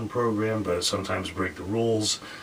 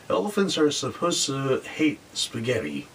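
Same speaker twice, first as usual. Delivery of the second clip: ordinary talk, nothing marked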